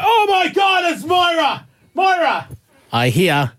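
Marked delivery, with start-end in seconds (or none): 0.0-2.5 high excited shout; yelling out. Retold or imitated. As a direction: shout like spotting a star. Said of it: "as I'm yelling out".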